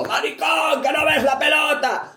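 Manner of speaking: high mock shout at a player from the stands; imitated fan shout. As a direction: yell it like a rowdy woman in the stands. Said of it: "le dice a un tío que está jugando". (grito imitado de aficionada en la grada)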